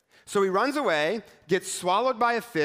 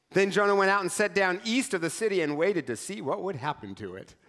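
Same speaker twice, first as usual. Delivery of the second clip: usual speaking voice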